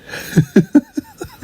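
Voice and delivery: a short disbelieving laugh; incredulous laugh. Real, not acted. Laughs at the ex's message.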